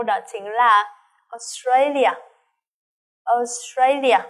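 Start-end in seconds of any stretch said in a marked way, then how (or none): 1.3-2.1 Australia opening on a deep, long O. Shown as a demonstration. Teacher models Australia with a deep O sound. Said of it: "cái âm O rất là sâu". 3.2-4.2 Australia opening on a deep, long O. Shown as a demonstration. Teacher models Australia with a deep O sound. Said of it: "cái âm O rất là sâu".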